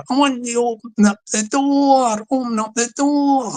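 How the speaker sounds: high, stretched-out mock demand; sing-song it up high like a comic gangster; hammed-up high-pitched call at the door; hitting high notes. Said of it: "hitting the high notes there".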